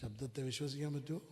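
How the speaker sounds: low, soft, fast question; brisk, low-voiced query; quick question. Real, not acted. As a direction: ask it quickly and quietly. Same question asked quickly, then drawn out.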